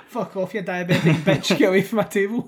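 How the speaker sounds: loud, laughing put-on yell; hammed-up telling off the old lady; mock angry shout. Acting out rude hotel guest.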